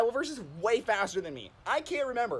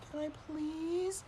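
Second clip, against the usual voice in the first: high, soft, drawn-out begging; slow, small pleading voice; pleading little girl; 7 semitones higher, 9 dB quieter, slower than usual. Retold or imitated. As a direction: imitate a kid's puppy-dog plea. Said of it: "this sad face and puppy dog tears".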